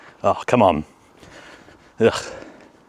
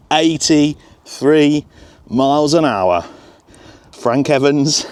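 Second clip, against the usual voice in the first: excited; 6 semitones higher, 3 dB louder, much slower than usual. Real, not acted. Announcing record speed result.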